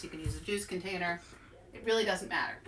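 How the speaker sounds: usual speaking voice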